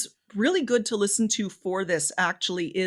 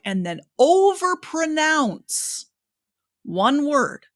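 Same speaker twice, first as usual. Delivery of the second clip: slow, higher, each syllable of "overpronounce" stretched out; overpronounced emphasis; 5 semitones higher, much slower than usual. Shown as a demonstration. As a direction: overpronounce the word to show the emphasis. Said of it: "he'll emphasize a word by overpronouncing it".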